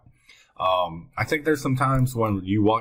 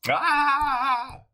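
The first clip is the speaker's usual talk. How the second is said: high, drawn-out "ah" of dismay; frustrated cry. Real, not acted. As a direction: cry out high at your own mistake. Catches own mistake.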